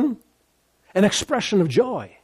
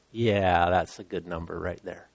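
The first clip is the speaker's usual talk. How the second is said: soft, hushed voice of God, much quieter; 8 semitones lower, 4 dB quieter than usual. Retold or imitated. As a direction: speak as God, quietly and intimately. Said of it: "And God goes".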